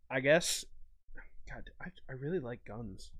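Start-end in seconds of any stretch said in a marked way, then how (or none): 1.5-3.1 hushed, breathy admission; half-whispered aside; soft confession. Real, not acted. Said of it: "That sounded super weird".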